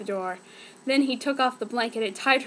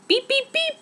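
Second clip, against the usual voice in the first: high electronic beeps, much higher; mock computer beeps; 13 semitones higher than usual. Narrator imitating the computer alarm, high beeping.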